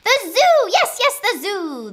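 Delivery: high, loud, delighted outburst; excited shout. A narrator's reading. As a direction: cry out your brilliant idea. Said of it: "she shouted with a jump".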